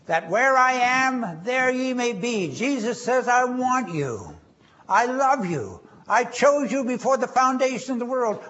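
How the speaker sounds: high, loud and impassioned; preacher rising high and loud as he voices Jesus; fervent voice of Jesus. Acted. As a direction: lift your voice, pleading and loving. Preacher voicing Jesus' words.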